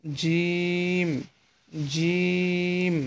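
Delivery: "জিম" from mid-tongue on the palate, long steady vowel; palatal articulation. Shown as a demonstration. Teacher shows learners where the letter forms, "জিম" palatal and drawn out. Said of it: "জিহ্বার মাঝখান তার বরাবর ওপরে তালুর সঙ্গে".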